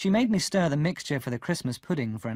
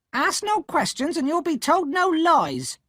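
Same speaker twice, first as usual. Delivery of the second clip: much higher, louder snap; snapping; 6 dB louder than usual. A narrator's reading. Sister cutting off Pip's question, snapping. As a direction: snap it back curtly. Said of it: "my sister snapped".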